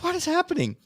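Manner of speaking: sudden high alarmed cry. Retold or imitated. Storm roars outside, she cries out in alarm. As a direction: blurt it out in shock. Shocked reaction to storm noises.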